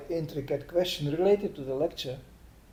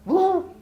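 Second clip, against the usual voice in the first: mock whoop; 14 semitones higher, 7 dB louder than usual. Mocking the crazy man.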